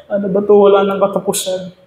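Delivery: despair, close to tears; crying teacup. A narrator's reading. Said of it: "at umiyak na siya". (mataas at umiiyak na panaghoy)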